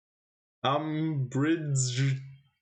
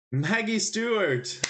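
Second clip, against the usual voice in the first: much higher and slower, thrilled to have it; excited exclamation; 10 semitones higher, 4 dB louder, slower than usual. Real, not acted. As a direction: call the name out like a winning guess. Excited guess at the answer.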